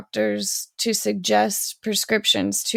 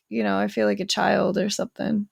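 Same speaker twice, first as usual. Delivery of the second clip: ordinary delivery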